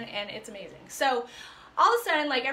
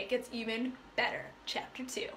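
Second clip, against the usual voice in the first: secret-telling whisper; hushed, conspiratorial tease of what comes next; 4 semitones lower, 9 dB quieter than usual. Real, not acted. Teasing the next chapter.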